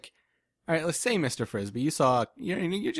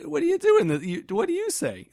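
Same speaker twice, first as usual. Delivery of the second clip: incredulous; 4 dB louder than usual. Real, not acted. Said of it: "baffled rhetorical question".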